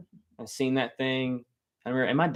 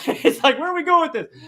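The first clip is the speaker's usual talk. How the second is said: laughing at himself; 13 semitones higher, 7 dB louder than usual. Pretend. Mocking his own tangent.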